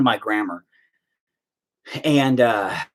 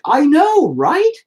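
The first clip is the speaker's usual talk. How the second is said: excited agreement; 13 semitones higher, 5 dB louder, much slower than usual. Retold or imitated. Quoting himself to his wife.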